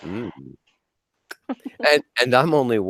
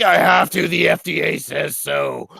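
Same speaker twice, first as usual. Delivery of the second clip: stern farmer; 9 semitones higher than usual. Acted. Farmer answers the cow.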